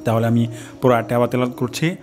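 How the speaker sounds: ordinary talk, nothing marked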